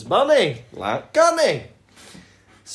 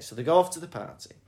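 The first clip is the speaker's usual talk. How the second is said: usual speaking voice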